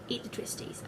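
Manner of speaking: hushed mock whisper of dad; breathy playful whisper. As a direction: whisper it like a secret tip. Dad's mock advice hushed.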